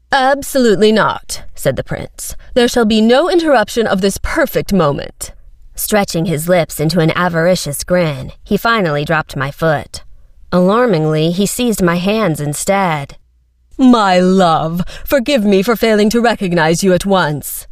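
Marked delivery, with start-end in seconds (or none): 0.1-1.4 haughty prince. A narrator's reading. Prince refuses. 2.5-5.3 pompous prince. A narrator's reading. 13.8-17.7 oily suitor. A narrator's reading. Prince wooing.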